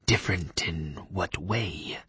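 slow hushed question; whispering vampire. Acted. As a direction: breathe it out slow and cool. Leif asks, whispering.

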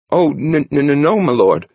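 high frightened stammer. A narrator's reading. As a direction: stutter the denial in panic. Terrified novice stammers denial.

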